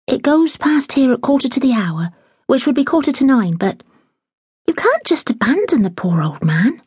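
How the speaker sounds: a hint of a curious young girl; young maid pressing the housekeeper for news; eager young maid. Daisy voiced in reading.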